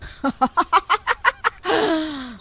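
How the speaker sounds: high, long witch cackle, falling away; evil cackle. Acted. Witch as three villagers faint, gloating cackle. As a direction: cackle in triumph over your victims.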